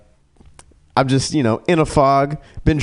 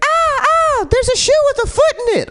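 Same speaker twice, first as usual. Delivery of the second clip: mock shriek. Girl in backseat spots a foot in a shoe, shrieking. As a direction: shriek like you just saw a severed foot. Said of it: "the same girl starts yelling".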